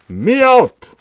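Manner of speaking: a loud, high yell; a big playful shout. Pretend. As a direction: holler it out like a shout-out. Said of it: "Can you shout me out".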